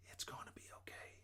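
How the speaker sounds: very soft, voiceless reassurance; whispered. A narrator's reading. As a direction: whisper comfort, barely audible. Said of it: "she whispered".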